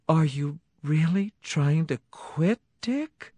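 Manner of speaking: high, slow hopeful question; hopeful wife voice. Acted. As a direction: ask gently, hoping. Cindy voiced by narrator.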